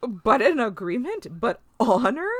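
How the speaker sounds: be scandalized about honor; indignant Worf. Retold or imitated. To friends, imitating Worf outraged about honor. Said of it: "He's like".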